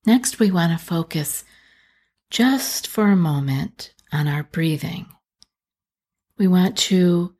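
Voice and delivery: calm meditation guide, unhurried, with long pauses between phrases; guided meditation. Acted. Coach guiding distressed listeners through breathing, calm guided meditation. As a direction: ease them gently through each breath, leaving space after it.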